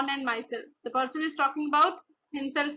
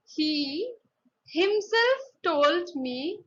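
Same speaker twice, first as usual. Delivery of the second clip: "himself" drawn out and raised in pitch; emphasis on HIMSELF; 3 semitones higher, much slower than usual. Shown as a demonstration. Teacher repeating the example, slow high stress on "himself". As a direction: pause after "He", then stretch "himself". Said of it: "put emphasis on the particular noun".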